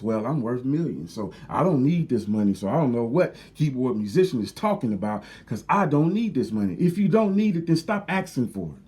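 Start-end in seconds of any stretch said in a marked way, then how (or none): 0.0-6.7 a lower, smug mock of a con man saying he needs nothing. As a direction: sneer it like a fake rich beggar. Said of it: "when they start talking like this".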